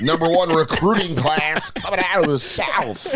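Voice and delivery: high, hammed-up broadcast voice; mock announcer. Announcer presenting Coach Saban on the sidelines. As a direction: call it like a sports broadcaster. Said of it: "mock announcer about Saban".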